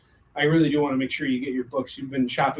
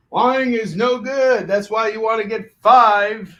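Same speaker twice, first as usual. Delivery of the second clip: mock hype; 6 semitones higher, 9 dB louder, slower than usual. Mock hard sell, loud slow.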